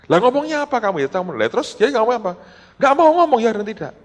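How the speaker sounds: high-pitched, agitated mock argument; retold angry exchange. Retelling the quarrel after the pastor stormed home, mock indignant. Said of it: "Pulang marah-marah".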